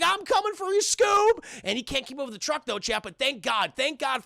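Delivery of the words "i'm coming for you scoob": high-pitched cartoon slacker calling out; Shaggy. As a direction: play the loyal sidekick rushing to the rescue. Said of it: "Shaggy's like".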